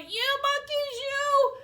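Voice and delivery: high, loud storybook shouting; angry shout. A narrator's reading. Peddler stamping both feet at the monkeys, shouting. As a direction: shout it high, hammering the demand. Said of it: "he shouted".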